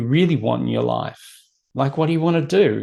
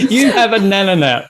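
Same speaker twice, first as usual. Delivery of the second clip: laughing tease, higher and louder; 6 semitones higher, 5 dB louder than usual. Real, not acted. Host teasing the guest about naps, laughing. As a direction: tease her through a laugh.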